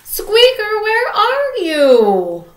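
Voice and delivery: high, loud, slow call for a lost pet. Acted. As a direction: call out searching worriedly. Lucy searches for her pet.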